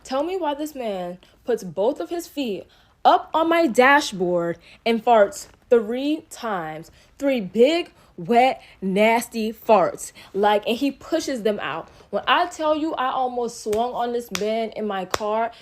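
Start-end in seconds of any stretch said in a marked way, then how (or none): 3.7-10.1 loud, drawn-out words in appalled disbelief; disgusted emphasis. Real, not acted. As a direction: punch each word slowly, grossed out. Disgusted storyteller spelling out the outrage.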